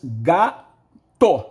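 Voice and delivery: "gato," loud, split in two beats; teacher sounding out; syllables split. Shown as a demonstration. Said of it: "se gato tem duas sílabas".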